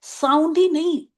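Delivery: normal talking voice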